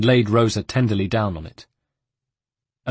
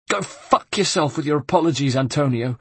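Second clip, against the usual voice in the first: higher, pained angry yelp; 6 semitones higher than usual. A narrator's reading. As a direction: yelp it out in pain. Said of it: "yelped Rosa".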